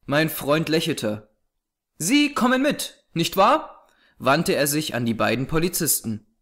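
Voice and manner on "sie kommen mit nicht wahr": much higher, questioning detective voice; high-pitched, confident invitation; brisk, commanding detective. Acted. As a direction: address the policemen in a raised, lively pitch.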